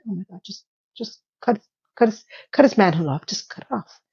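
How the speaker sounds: dropped to a near-whisper, fed up; muttered aside. Real, not acted. Viewer grumbling at the show in a near-whisper. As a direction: mutter under your breath to the TV.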